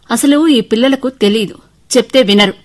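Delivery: loud, exasperated scolding; annoyed mother. Acted. Mother fed up with children who never listen, angrily. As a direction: scold with rising irritation. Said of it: "కోపం వచ్చింది నాకు".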